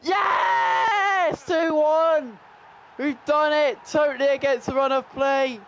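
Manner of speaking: screamed, very high goal celebration; voice-cracking celebration. Real, not acted. Gamer celebrating a late winning goal, voice cracking. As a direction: scream with joy until the voice cracks. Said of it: "I've done another voice crack".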